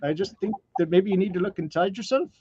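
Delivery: put-on gentle Kiwi accent, a bit hammy; Korg New Zealand accent. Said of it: "my New Zealand accent's probably horrible".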